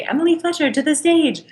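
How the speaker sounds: high, far-off announcer call over the speaker; a stage manager paging her to the stage, in a high intercom call. Retold or imitated. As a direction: page her like a backstage intercom. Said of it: "someone would get on the intercom and say".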